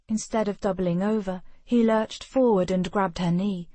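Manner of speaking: ordinary delivery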